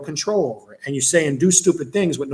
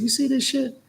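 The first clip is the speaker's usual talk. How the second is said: incredulous; 10 semitones higher, 5 dB quieter than usual. Retold or imitated. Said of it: "I looked at her, I said".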